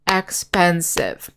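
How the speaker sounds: slow, clear expensive, syllable by syllable; syllables spelled out slowly. Shown as a demonstration. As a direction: break expensive into its three parts. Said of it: "expensive has three syllables".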